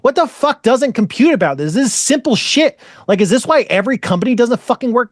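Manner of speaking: high-pitched, incredulous venting; exasperated outburst. Retold or imitated. Speaker baffled by a co-worker over simple stuff, exasperated. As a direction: rant in disbelief without shouting.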